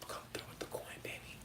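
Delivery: very soft whisper; whispered aside. Real, not acted. Sly aside asking for payment.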